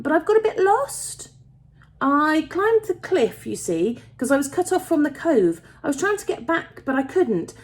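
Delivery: put-on high-pitched girl's voice, anxious to explain; tentative lost girl. A narrator's reading. Lost girl asking miners for help home, high and tentative.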